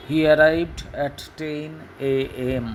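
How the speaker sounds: each word spaced out for the class; slow dictation. Shown as a demonstration. Teacher dictating the example to pupils, slow and clear. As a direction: read slowly like a model sentence.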